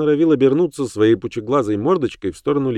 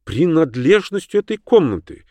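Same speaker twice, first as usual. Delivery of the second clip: gritted-teeth disdain; 8 semitones higher than usual. Acted. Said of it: "процедила она".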